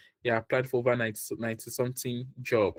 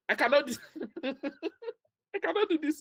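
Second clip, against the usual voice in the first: high-pitched, helpless laughter through the words; laughing; 13 semitones higher than usual. Real, not acted. Job coach breaking off mid-advice, laughing. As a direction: crack up and struggle to get it out.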